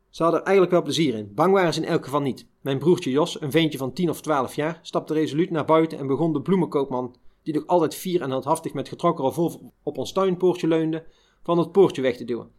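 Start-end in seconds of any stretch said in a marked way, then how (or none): none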